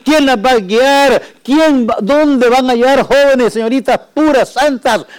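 high, loud desperate pleading prayer; crying out to God. Pretend. Preacher voicing parents' prayer, anguished loud plea. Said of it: "clamando a Dios".

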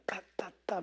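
sharp whispered "ta, ta, ta" thumps; voiceless slapping sound effect; imitated back slaps. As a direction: mimic quick smacks on a back. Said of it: "pegó a pegar la espalda".

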